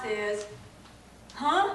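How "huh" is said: high, loud, incredulous query; comic startled question; mock bewilderment. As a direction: react as if you can't believe it. Acting out the stunned usual response.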